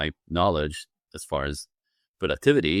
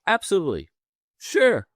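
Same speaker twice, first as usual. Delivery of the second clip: high, bright-eyed instant agreement, the sure leaping higher; pitched-up eager acceptance; eager yes; 11 semitones higher, 4 dB louder than usual. Retold or imitated. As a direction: jump at the offer. Eagerly accepting the workshop invite.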